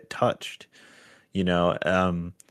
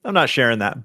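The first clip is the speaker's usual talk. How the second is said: raised, louder brush-off; waving the idea away; mock dismissive; 9 semitones higher, 5 dB louder than usual. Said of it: "I'm like".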